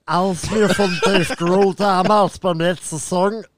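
langsame, dümmliche Comedy-Stimme; Ottmar. Retold or imitated. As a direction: wie eine trottelige Sitcom-Figur vorlesen. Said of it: "unseren Lieblings-Pastewka. Der Ottmar". (dumme Ottmar-Stimme)

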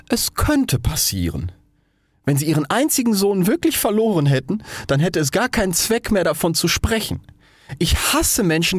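high, huffy and theatrical; affected rocket. Acted. Rocket defends its feelings to the Roman candle, high and huffy. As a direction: protest with wounded pride.

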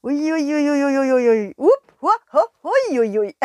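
loud high wobbling ui ui; bumpy ride squeals. Acted. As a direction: bounce through the bumps, squealing. Bumpy landing on hill.

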